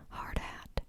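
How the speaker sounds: plain reading voice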